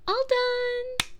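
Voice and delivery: bright high "All done!"; cheery chirp. Acted. Girlfriend proudly finishing the towel bath, high and cheery. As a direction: sing it out, pleased with herself.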